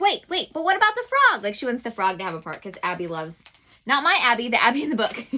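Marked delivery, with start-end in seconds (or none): 0.0-1.4 put-on high, eager child's interruption. The girl in the book interrupts her dad, high and eager. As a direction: butt in like an excited kid. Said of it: "Abby's like".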